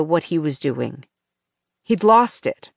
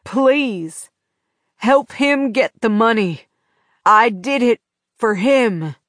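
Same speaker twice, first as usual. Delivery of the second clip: pleading; 4 semitones higher than usual. A narrator's reading. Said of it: "Sheriff Haynes pleaded".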